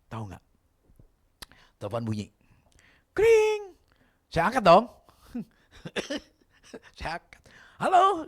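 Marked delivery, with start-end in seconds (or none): 3.1-3.8 high, loud imitated phone ring. Preacher imitating the phone ringing, high and loud. 7.8-8.2 high, loud hello; boss on the phone. Retold or imitated. Boss picking up the phone, high and loud.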